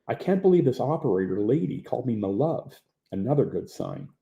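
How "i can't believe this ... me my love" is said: normal talking voice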